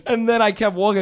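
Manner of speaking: ordinary delivery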